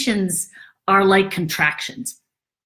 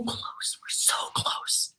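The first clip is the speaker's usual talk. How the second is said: a much softer, slow whisper; 13 dB quieter, much slower than usual. Acted. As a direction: hush it out, almost there. Building suspense.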